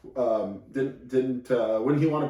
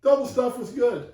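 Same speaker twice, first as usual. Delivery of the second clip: mock high voice; 11 semitones higher than usual. Joking voices about Oreos.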